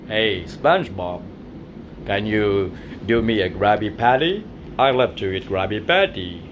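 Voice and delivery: deep cartoon crab voice; Mister Krabs voice. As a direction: growl it out like the crab boss. Boss voice ordering burger.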